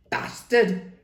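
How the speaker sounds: spat out in anger; sharp spat insult. Acted. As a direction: spit the word at him. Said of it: "he spat".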